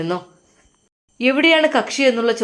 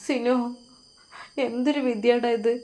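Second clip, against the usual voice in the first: tearful lament; 4 semitones higher, 6 dB quieter than usual. A narrator's reading. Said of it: "അവൻ കരഞ്ഞുകൊണ്ട് മോൾട്ടിയും".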